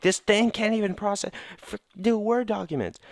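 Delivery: high, strained complaining at the computer; squeaky frustrated rant at a computer; frustrated whine. Retold or imitated. Mad at old computer, acted quote.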